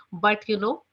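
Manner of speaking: ordinary talk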